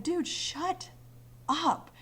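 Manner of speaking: high-pitched mock scolding; retold shut up. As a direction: snap it at him, pitch jumping way up. Friends telling rude player off.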